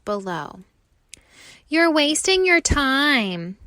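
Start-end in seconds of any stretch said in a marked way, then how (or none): 1.7-3.6 higher, louder know-it-all jeer; mocking leaves. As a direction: scoff at his effort, loud. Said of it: "the other leaves say".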